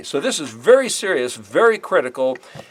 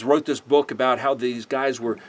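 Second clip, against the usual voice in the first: ordinary delivery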